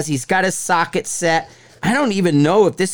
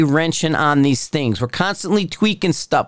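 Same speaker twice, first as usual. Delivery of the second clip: usual speaking voice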